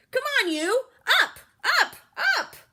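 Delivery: high, loud yell at a leaf. A narrator's reading. Camilla yelling at a leaf to wake up. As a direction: shout as if rousing a sleeper.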